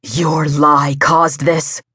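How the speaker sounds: slow, accusing sneer. A narrator's reading. Wolf blaming his brother for the loss, sneering. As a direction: sneer it slowly in his face. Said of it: "Rafe sneered in his brother's face".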